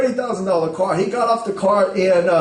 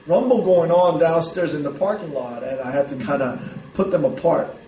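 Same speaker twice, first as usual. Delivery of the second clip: ordinary delivery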